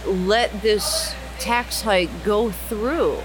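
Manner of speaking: ordinary delivery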